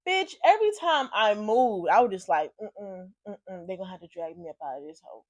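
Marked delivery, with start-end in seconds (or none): 2.6-5.2 very low, hushed grumble; muttered refusal. Retold or imitated. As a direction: mumble it quietly to yourself. Acts out refusing to move.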